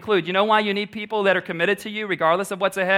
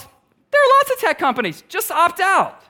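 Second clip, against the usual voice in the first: much higher, louder sing-song brush-off; breezy mocking shrug; mock flippant voice; 11 semitones higher, 5 dB louder than usual. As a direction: toss it off like cheap advice. Mocking voice of easy advice.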